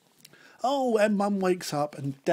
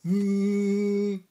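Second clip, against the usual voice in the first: fridge hum; 3 semitones higher, 7 dB louder than usual. Acted. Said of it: "went the refrigerator".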